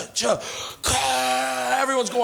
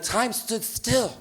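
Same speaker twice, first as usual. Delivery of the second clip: drawn-out, soft, awed; dramatic slow; 5 dB quieter, much slower than usual. Retold or imitated. Retelling the magic moment, slow and hushed. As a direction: stretch every word like a movie line. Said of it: "someone's like".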